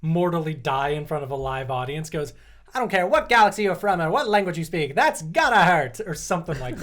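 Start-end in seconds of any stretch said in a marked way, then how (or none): 2.7-6.0 loud, higher-pitched sports announcer; pod race announcer. Retold or imitated. Announcer watching a pod race crash, loud commentary. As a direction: call the crash like an excited commentator. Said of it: "announcer during the pod race in the movie".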